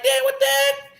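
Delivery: high-pitched astonished squeak; stunned at the dog lying down; amazed exclaim. Retold or imitated. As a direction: react in disbelief, way up high.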